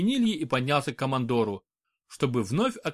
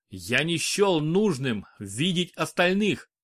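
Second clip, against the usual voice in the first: usual speaking voice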